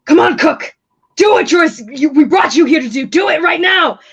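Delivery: loud, high, furious yell; yelling into earpiece. Acted. Thug yelling at his accomplice through the earpiece, furious. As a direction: bark orders at the accomplice, full volume. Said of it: "he's yelling at her in the earpiece".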